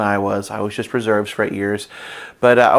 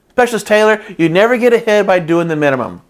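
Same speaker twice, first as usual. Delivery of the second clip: mock drill sergeant; 7 semitones higher, 4 dB louder than usual. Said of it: "He was a little louder voice than that".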